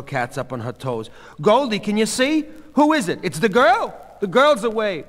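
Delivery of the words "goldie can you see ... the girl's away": high, loud, urgent questioning; excited onlookers. A narrator's reading. Mrs. Katz asking Goldie who it is, excited. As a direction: call out eagerly over a crowd.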